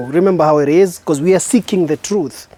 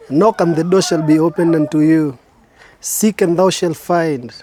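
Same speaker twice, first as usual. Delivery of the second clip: solemn recital. Acted. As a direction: intone the verses like an opening spoken word piece. Opening recited scripture passage.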